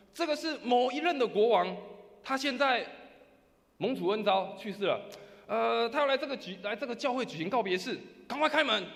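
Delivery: high, loud proclamation; loud shout. Acted. Procession leader announcing the dead king at the door, loud shout. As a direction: shout it formally through a closed door. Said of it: "会再大声喊说".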